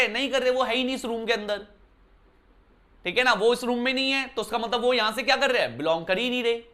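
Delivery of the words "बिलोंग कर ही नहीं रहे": ordinary talk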